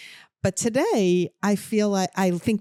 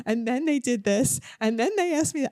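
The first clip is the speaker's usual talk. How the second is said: put-on storytelling voice for the baby; 5 semitones higher than usual. Retelling the scam to the baby.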